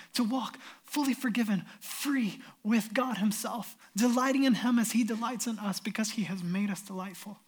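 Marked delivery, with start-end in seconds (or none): none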